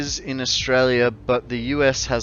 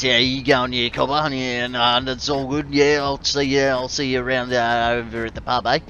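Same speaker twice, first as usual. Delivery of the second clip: mock bush Aussie bloke chatting at the pub; broad bush Aussie; faster than usual. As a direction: play up a bush Australian accent. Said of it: "the more you get like this".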